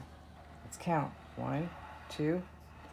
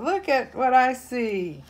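higher, louder delighted cub; excited roar; 7 semitones higher, 6 dB louder than usual. Acted. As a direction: call out the discovery with glee. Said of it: "roars the lion cub".

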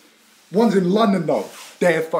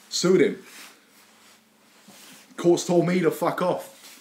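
normal talking voice

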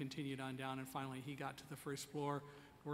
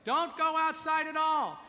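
loud, high shouted orders in the lobby; yelling directions; 11 semitones higher, 10 dB louder than usual. Retold or imitated. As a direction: shout the crowd directions over the noise. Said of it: "everybody was yelling".

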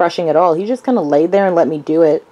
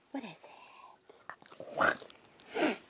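usual speaking voice

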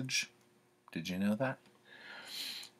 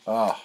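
loud exasperated ugh; frustrated groan; 3 semitones higher, 11 dB louder than usual. Real, not acted. Can't spell, frustrated groan.

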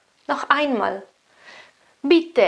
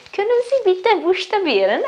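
polite sweet request; 10 semitones higher than usual. Shown as a demonstration. Teacher models a nicer polite way to ask for spelling. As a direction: ask charmingly with a lifted voice. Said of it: "È un pochino più carino".

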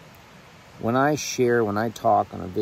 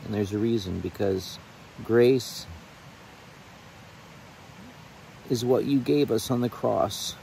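heartfelt prayer; 2 semitones higher than usual. Real, not acted. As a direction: pray aloud to the Lord from the heart. Sorry, guys, if I get a little bit emotional.

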